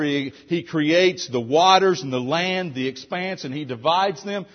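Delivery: ordinary delivery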